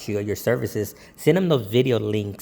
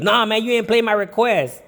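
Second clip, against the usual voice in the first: annoyed guest; 10 semitones higher, 4 dB louder than usual. Retold or imitated. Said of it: "he was being, uh, a butthead".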